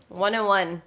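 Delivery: usual speaking voice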